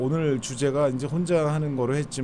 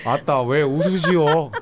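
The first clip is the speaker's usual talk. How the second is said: Honam dialect; 5 dB louder, slower than usual. Shown as a demonstration. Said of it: "그런 사투리가 있고".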